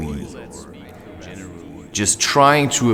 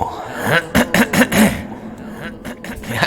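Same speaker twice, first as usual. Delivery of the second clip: a real throat-clearing cough after spicy food; 9 semitones higher than usual. Real, not acted. Throat burning from chili, a real cough.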